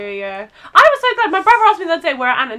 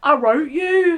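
put-on Brummie film line; mock-romantic Brummie accent; slower than usual. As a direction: imitate a lovelorn hero in broad Birmingham. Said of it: "I think the Bromley one is".

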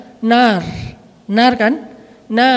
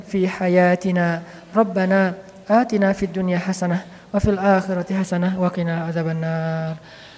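closing prayer; 3 semitones lower than usual. Acted. Preacher closes the study session with a prayer for everyone, calm supplication. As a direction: pray for the gathering, steadily.

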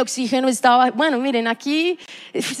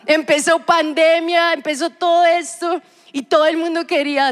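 ordinary delivery

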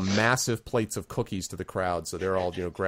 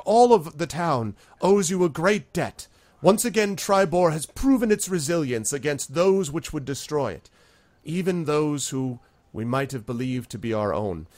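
noble town leader, formal speech; 6 semitones higher, 4 dB louder than usual. Acted. Town leader addresses the heroes, lofty and ceremonial. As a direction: lift the voice and address the whole town.

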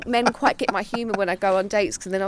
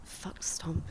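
very soft whispered aside; hushed, dismissive mutter; 5 semitones lower, 15 dB quieter than usual. Real, not acted.

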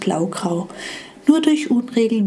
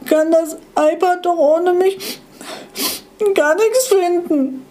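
high, louder hurt little voice; small crying device complaining; tearful little gadget; 9 semitones higher, 3 dB louder than usual. Acted. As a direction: whine through the tears. Little Hollux still tearful.

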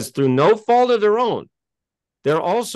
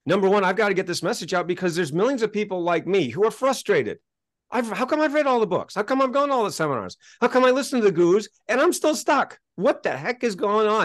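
higher, exasperated rapid questions; frustrated venting; 6 semitones higher than usual. Retold or imitated. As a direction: pour out the frustration of someone stuck. Frustrated self-help seeker venting.

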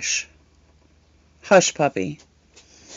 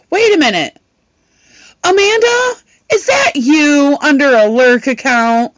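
sudden realization; 6 semitones higher than usual. Retold or imitated. Friend realizing who the lurker is, very high. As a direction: shoot up high as it dawns.

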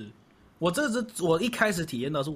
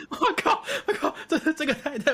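excited; 13 semitones higher, 4 dB louder than usual. Retold or imitated. 回想被感动时惊叹.

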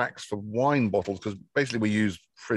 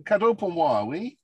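raised, eager French question; excited; 11 semitones higher than usual. Real, not acted. [name] asks the postman for his parcel, eagerly excited. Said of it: "hear the excitement in [name]'s".

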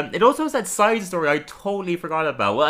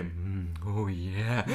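contented Homer; 7 semitones lower, 9 dB quieter, much slower than usual. Retold or imitated. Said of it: "he's like".

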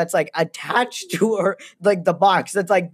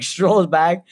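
normal talking voice

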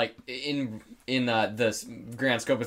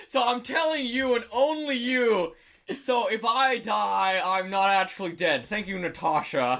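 hammy hero voice; 8 semitones higher than usual. Pretend. Hero confiding plan to Natasha.

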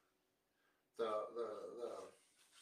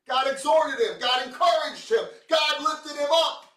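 full-force preaching; 11 semitones higher, 14 dB louder than usual. Acted.

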